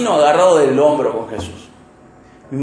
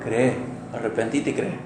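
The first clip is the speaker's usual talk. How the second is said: soft command; 7 semitones lower, 10 dB quieter than usual. Acted. Christ's answer, voiced softly.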